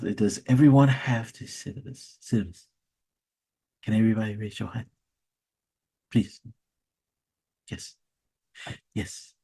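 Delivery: quiet Nigerian professor droning, quick on the hand request; flat drone asking for the "syllabus"; low monotone Nigerian accent. Retold or imitated. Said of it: "they always talk in monotone".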